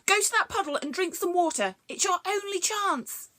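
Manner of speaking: frantic creature; panicked. Acted. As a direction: urge her on in a panic. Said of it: "in a panic".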